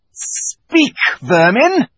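sneering witch command; contemptuous command. Acted. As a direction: order the creatures to talk, with scorn. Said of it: "she said again".

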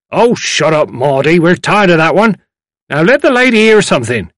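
put-on loud, high old man cutting in; fed-up elder shushing the singer; impatient elderly gentleman. A narrator's reading.

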